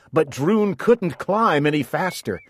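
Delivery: ordinary delivery, nothing marked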